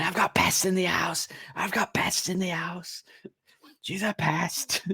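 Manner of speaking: high, slow, put-on madman; deranged ranting. Naked killer running round outside the house, deranged ranting. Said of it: "running around outside the house naked".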